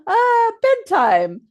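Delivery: high drawn-out model word. Shown as a demonstration.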